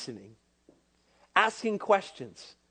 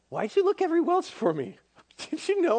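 put-on exasperated kid; 10 semitones higher, faster than usual. Boy Jesus answering his frantic parents.

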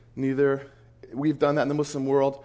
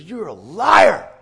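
slow, loud, higher-pitched accusation; shouted accusation; 4 semitones higher, 10 dB louder, much slower than usual. Retold or imitated. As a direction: hurl it in his face, drawing it out. Man accuses him face to face.

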